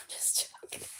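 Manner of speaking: whispered tease; whispering. Real, not acted. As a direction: whisper it with a grin. Teasing aside.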